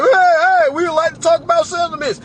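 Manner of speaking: high, loud, eager corporate caller; eager company caller. Retold or imitated. Company rep calling clients to settle, high loud eager voice. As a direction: chirp it like a rep begging to settle. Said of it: "clients that's getting them calls now, like".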